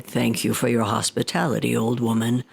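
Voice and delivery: low demon voice, politely grand; a deep-set demon taking his leave; Tengu demon voice. Acted. Demon thanking the old woman, low and grand. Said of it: "that Tengu rose to his feet and said".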